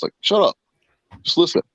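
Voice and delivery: impatient rebuke; exasperated. Retold or imitated. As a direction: shut him down, fed up. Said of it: "He's like".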